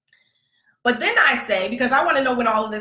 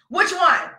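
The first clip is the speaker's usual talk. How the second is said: tense demand; 9 semitones higher than usual. Retold or imitated. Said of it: "I think I'm about to fight an eight-year-old".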